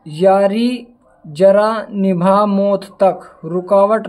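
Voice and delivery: slow, deliberate line for the class to memorise; slowly recited memory trick; slow mnemonic recital. Shown as a demonstration. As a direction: spell it out slowly so students can remember. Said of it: "भाई यारी का मतलब Y".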